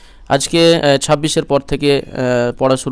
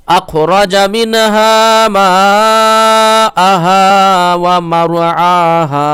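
higher, louder, melodic tilawat; Quran recitation; 4 semitones higher, 7 dB louder, much slower than usual. Acted. Teacher recites the verse for students in tilawat. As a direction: lift the verse into a full chant.

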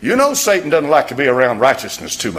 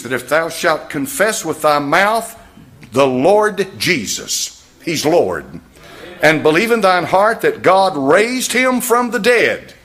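louder, emphatic sermon delivery; fervent preaching; 5 semitones higher, 6 dB louder, slower than usual. Acted. Preacher presses the verse on his congregation, emphatic and loud. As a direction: drive the verse home.

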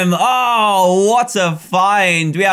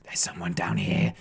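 hushed unvoiced whisper; 11 dB quieter than usual. Real, not acted. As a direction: whisper so the hider won't hear. Spotting a hider, sneaking.

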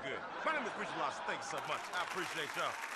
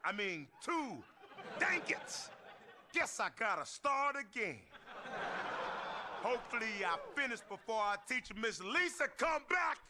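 hammed-up worry the teacher returns; mock panicked counting; 7 semitones higher than usual.

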